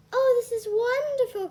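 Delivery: high, glad exclamation; delighted cry. Acted. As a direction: gasp it out in delight. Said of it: "cried Cinderella".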